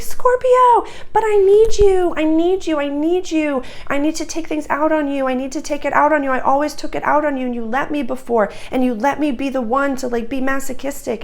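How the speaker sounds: mock the parents begging at the door; hammed-up high whine of a clingy parent; whiny needy parent. Parents voiced pleading at the door.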